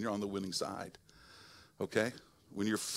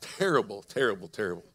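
mock lament; 3 semitones lower than usual. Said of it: "it's terrible".

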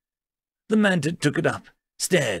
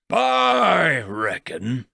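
very slow, stretched-out drawl; much slower than usual. A narrator's reading. Huge Terran in the doorway with a rifle, slow drawl. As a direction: draw each word right out in a drawl. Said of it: "his voice drawled through a built-in Vox translator".

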